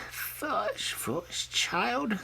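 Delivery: mother pig upset by Whitey's choice; distressed mother. A narrator's reading. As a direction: sigh it out over a foolish child. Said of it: "looking quite distressed".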